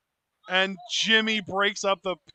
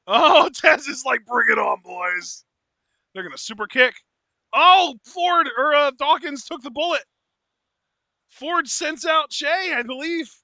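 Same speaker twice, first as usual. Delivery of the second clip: wrestling commentator; 7 semitones higher, 7 dB louder than usual. Acted. Live calling of the match.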